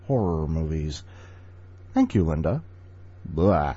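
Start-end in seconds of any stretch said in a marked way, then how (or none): 3.2-3.8 mock vampire blah. Shown as a demonstration. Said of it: "I like doing that".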